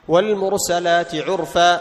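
slow, measured recitation of the verse; slow tajweed reading, held vowels; Quran recitation. Shown as a demonstration. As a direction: recite the verse deliberately, as in class. Recites verses to test pauses.

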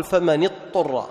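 اضطر with the ض swallowed into a doubled ط; wrong merged Dad. Shown as a demonstration. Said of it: "لا تقل".

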